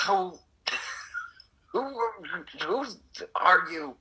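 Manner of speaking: startled. Retold or imitated. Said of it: "And I'm like".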